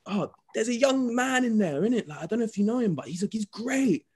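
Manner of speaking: high, gushing mum voice; high-pitched impression of a proud mum; imitating his mum. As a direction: play his mum raving about him, way up high. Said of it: "She's like".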